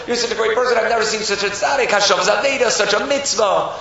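high, excited praise; gushing admiration. Acted. Townspeople going around praising a stranger, gushing. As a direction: marvel at him like a delighted crowd. Crowd keeps praising him.

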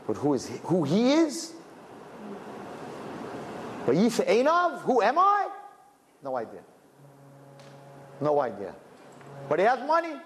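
high mock questions. Rabbi mocking the man's hollow identity, high and scoffing. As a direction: scoff at his empty self, pitch jumping up.

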